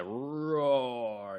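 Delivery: a long, playful spoken roar; drawn-out roar. Shown as a demonstration. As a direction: stretch the word into a lion's roar. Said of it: "It's the sound of the word".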